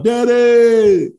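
put-on high, loud kid yell of Daddy; imitating his son's yell. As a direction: call out across the lot like an excited kid. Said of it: "he yells out".

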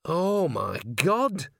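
high, drawn-out overwhelmed cry; acted tearful amazement; overcome, near tears. A narrator's reading. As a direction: draw it out as if welling up. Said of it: "burst into tears".

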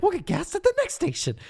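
squeaky high cartoon biker; high mock-carefree voice; silly high voice. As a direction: toss it off in a squeaky voice. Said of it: "He's like".

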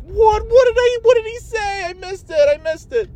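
very high squeaky panic; mock frantic self. Retelling missing the call in the car, squeaky panic. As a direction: squeal it like you missed everything. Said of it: "And I'm like".

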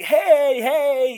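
high, loud long-range call; calling out, yelling. Retold or imitated. Friend Tom yells at a man across the parking lot, calling out. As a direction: holler to someone far across the lot. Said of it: "yelling at this dude across the parking lot".

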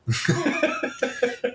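squeaky high laugh; high giggle. Real, not acted. Laughs at own flub.

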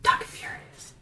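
soft whispered catchphrase. Acted. Co-host claims the catchphrase back, whispering. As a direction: whisper it as a mock title line. Echoes host's whispered title.

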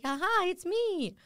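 high, slow, drawn-out hello; mock cute greeting. Tinder date greeting her from the front row, mock cute. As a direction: sing out a coy little hello.

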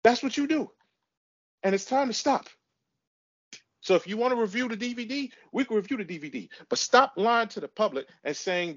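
high-pitched, insistent scolding; fed up, pitch riding high; exasperated. Real, not acted. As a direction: lay down the law to a co-host. Quarrel, telling co-host to stop.

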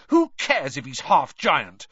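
loud, indignant outburst; shouted. A narrator's reading. Harry defends his friend to the reporter, shouting. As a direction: cut in loudly in defence. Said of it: "Harry shouted".

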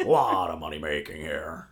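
very low, hammed-up Southern-style American accent; Jeremy Irons' American accent. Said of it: "it's like a Southern accent".

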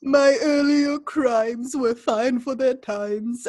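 slow, theatrical villain voice; slow hammed-up sinister line; Vincent Price impression. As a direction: stretch it out like a gleeful villain. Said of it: "Vincent Price".

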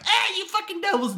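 very high mock girl's voice; Gabby voice. Mocking Gabby as she yells at the enemy in a high voice. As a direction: squeak it out like a bratty little girl. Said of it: "Gabby's like".

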